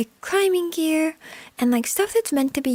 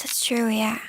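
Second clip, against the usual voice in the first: ordinary talk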